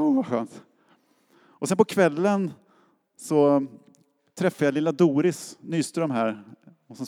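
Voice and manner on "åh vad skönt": much higher, sighing "Åh" of relief; relieved delight. Retold or imitated. Pastor answering colleagues' warm welcome, high relieved sigh. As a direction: melt with relief.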